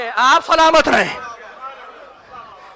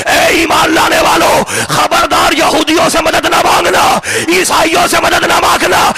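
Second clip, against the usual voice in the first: full-force preaching; 3 semitones higher than usual. Acted. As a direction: thunder the point at the crowd.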